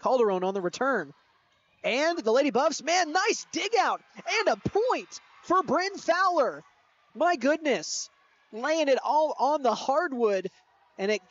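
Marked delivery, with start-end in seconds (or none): 1.8-10.5 excited. Real, not acted. Big dig, commentator thrilled.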